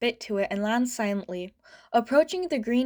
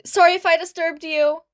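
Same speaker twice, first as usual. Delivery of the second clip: put-on flustered kid; 6 semitones higher, 7 dB louder than usual. A narrator's reading. Embarrassed kid apologises to hero.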